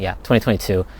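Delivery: ordinary talk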